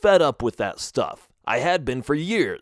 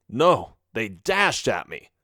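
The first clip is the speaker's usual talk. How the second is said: ordinary delivery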